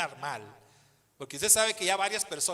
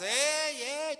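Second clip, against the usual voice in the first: high, sharp call from the queue; a crowd losing its patience; impatient shout; 11 semitones higher than usual. Retold or imitated. People waiting in line shouting at the cashier, high.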